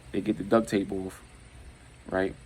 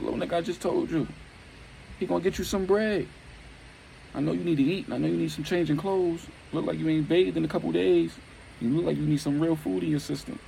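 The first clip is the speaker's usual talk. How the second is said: high-pitched, acted exasperated pleading; acted high, urgent coaxing; insistent persuading; 9 semitones higher than usual. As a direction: push the kid to agree, voice pitched way up. Chained man pressing the kid to help.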